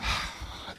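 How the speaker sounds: long breathy sigh, acted; weary sigh. Volunteer worn out by two and a half hours, weary sigh. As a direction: let out a worn-out exhale.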